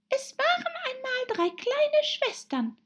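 very high, squeaky little voice; tiny high-pitched mouse storyteller; hurried dormouse. A narrator's reading. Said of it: "begann die Haselmaus in großer Eile".

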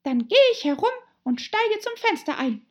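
high, loud little-animal voice; Rabbit talking to itself. A narrator's reading. Rabbit deciding on the window, high and determined. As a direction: resolve aloud in a high, forceful squeak. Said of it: "Alice hörte es zu sich sagen".

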